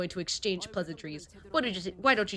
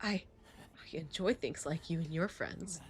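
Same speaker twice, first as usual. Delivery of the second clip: softer, low threat; menacing prince; 3 semitones lower, 9 dB quieter than usual. Acted. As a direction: threaten them quietly.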